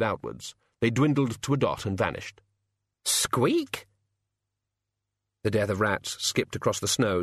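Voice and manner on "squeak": very high questioning squeak; tiny shrill squeak; high rat squeak. Acted. Death of Rats squeaking after the sledge, very high.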